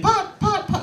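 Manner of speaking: put-on very high, happy toddler repeating a word. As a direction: chirp it like a proud two-year-old. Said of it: "he starts saying".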